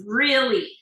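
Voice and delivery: loud emphatic "Really"; emphatic word. Shown as a demonstration. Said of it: "ese énfasis is really important".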